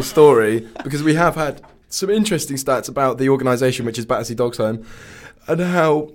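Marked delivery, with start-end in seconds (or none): 5.5-6.1 words stretched by a yawn. Real, not acted. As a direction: say it mid-yawn. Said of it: "sorry, I'm yawning".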